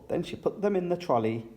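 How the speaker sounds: plain reading voice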